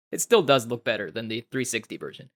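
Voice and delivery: ordinary talk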